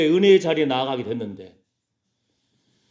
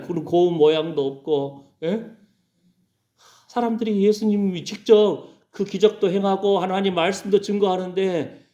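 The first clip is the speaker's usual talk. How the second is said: ordinary talk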